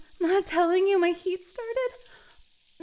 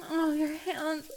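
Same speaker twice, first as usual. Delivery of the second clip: normal talking voice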